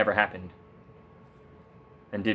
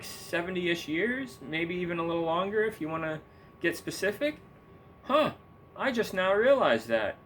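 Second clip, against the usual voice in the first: mock surprise; 7 semitones higher than usual. Feigned discovery of known news.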